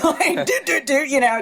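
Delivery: giggling through the words; high giggly laughter; laughing. Real, not acted. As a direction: laugh while you stammer. Laughing at herself.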